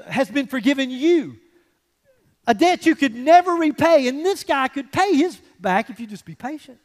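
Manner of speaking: congregation's mock outrage at the servant; indignant outrage. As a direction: speak as the outraged crowd, disbelieving. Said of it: "everybody starts to get a little angry".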